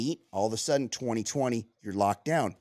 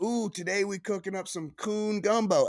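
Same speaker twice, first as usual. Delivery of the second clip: higher, playful Southern bayou cook voice; Southern bayou accent; 7 semitones higher than usual. Retold or imitated. Retelling the Instagram chef's cooking video in a Southern bayou accent. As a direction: imitate a Southern bayou chef hamming it up. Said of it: "He does kinda have that accent".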